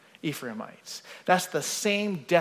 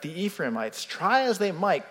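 normal talking voice

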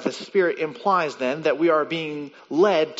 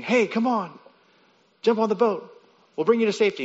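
calling out; 7 semitones higher, faster than usual. Pretend. Rescuers calling from the boat.

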